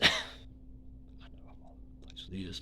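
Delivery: hushed, slow, laughing aside; whispered laugh. Real, not acted. Admitting to viewers he rambles, laughing whisper.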